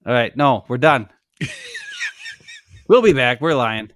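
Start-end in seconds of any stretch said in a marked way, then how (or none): 1.4-2.8 high-pitched laugh; soft, very high burst of laughter. Real, not acted. Joking about ending the show.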